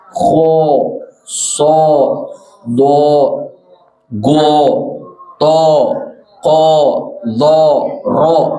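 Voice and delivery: letters read with rounded pouting o; pouted lips. Shown as a demonstration. Teacher testing the mothers with a wrong reading, pouted. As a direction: purse the lips into every vowel. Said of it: "saya baca dengan monyong".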